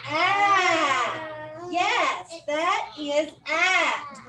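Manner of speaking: "Ah" open and loud, slowly; short a sound. Shown as a demonstration. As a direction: stretch the vowel "ah" openly. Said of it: "ah is a very special letter".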